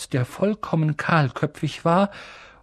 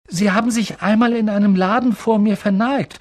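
put-on higher, eager boy's voice; excited recognition; 7 semitones higher, 4 dB louder than usual. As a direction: blurt out the memory eagerly. Harry excitedly recognises Dickel.

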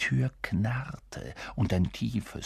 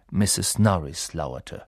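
ordinary delivery, nothing marked

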